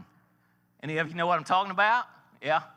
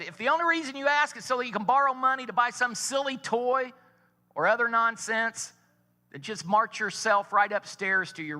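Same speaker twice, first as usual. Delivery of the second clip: raised, loud angry father scolding; furious father; 5 semitones higher, 3 dB louder than usual. A narrator's reading. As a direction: scold the boy hotly, voice up high. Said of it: "the father was furious".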